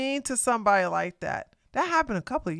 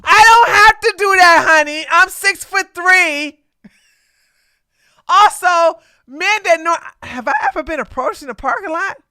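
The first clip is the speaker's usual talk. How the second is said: loud, much higher, sassy brag; sassy loud retort; 8 semitones higher, 13 dB louder than usual. Real, not acted. Co-host scoffing at the parking lot advice, loud sassy retort. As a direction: belt it out, amused and defiant.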